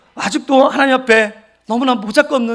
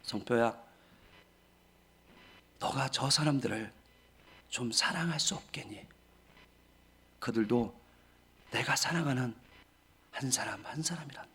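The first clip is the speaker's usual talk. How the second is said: much softer, lower, near-whispered; God's gentle voice; 9 semitones lower, 15 dB quieter than usual. Retold or imitated. God answering the angry pastor backstage, hushed and soft. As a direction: speak as God, hushed and tender. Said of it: "이렇게 말씀하는 거예요".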